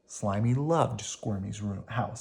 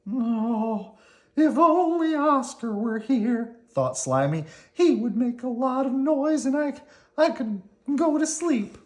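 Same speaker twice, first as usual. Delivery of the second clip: high, slow worm voice, wistful; Slimy wistful; 12 semitones higher than usual. Acted. Slimy can't sleep and misses Oscar, in a high wistful voice. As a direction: sigh for noisy Oscar.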